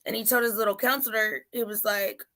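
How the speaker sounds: ordinary delivery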